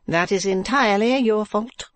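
high wife's voice; Madame Mantalini. Acted. Wife chiding her husband, high character voice.

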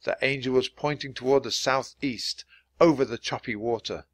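usual speaking voice

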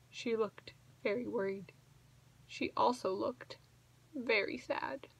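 hushed storytelling. A narrator's reading. Reading to a child, softly telling how sad Mama is. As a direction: drop to a hush for the sad part.

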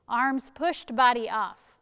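ordinary delivery

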